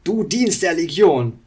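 loud, slow accusation; accusing. Acted. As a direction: hurl the charge at him. Wandel accuses Illidan. (laute Anklage)

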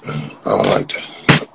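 breathy whisper of the same line; quiet whispered repeat. Real, not acted. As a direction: hush it under your breath. Repeats the line, then drops it.

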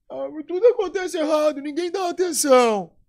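whiny, high and loud complaining voice; aluno carente choramingando. Retold or imitated. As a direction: play the self-pitying student who feels ignored. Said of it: "Então, ele". (voz chorosa e alta de quem se faz de vítima)

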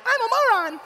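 mock high-voiced airhead girlfriend; high-pitched caricature of a silly girlfriend; dim girlfriend. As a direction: play the girlfriend as an exaggerated airhead. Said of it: "like, the girlfriend".

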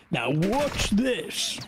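slow drawn-out Clarkson "Now watch this"; Clarkson impression. Mimicking Clarkson for the squad, slow and drawn out. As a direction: stretch it like Jeremy Clarkson teasing a stunt. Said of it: "the thing where he goes".

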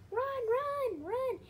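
frantic squeaky run run run; panicked little high voice shouting; high excited cry. Acted. Turkeys flapping in panic, high frantic cry. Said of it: "they're all just like".

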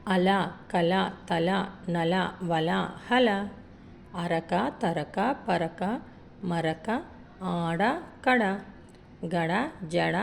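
each word drawn out slowly; slow, careful recitation for children; slow reading demo. Shown as a demonstration. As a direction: read slowly, word by word, for the class. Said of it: "ఎలా చేస్తానో చూడండి".

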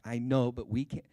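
acted outburst at dad, very loud; angry little kid. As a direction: cut in like an exasperated five-year-old. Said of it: "an angry little five-year-old".

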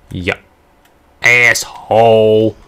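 slow, loud insult; angry. Real, not acted. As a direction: drag out the insult loudly. Cursing at boss.

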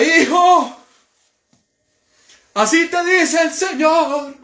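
high, loud, slow mock prophet voice; mocking a false prophet's high prophecy. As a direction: ham up a fake prophet's oracle. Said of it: "esperando al profeta que ... le diga".

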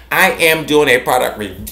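ordinary talk, nothing marked

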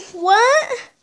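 high, loud startled laugh; surprised. Acted. Friend hearing they skipped Stardust, laughing surprise. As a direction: yelp it out in disbelief with a laugh.

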